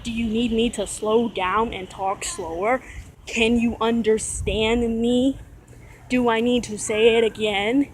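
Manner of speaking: slow, over-enunciated mock condescension; condescending. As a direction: mimic talking down, stretching each word. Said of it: "and condescending, saying things like".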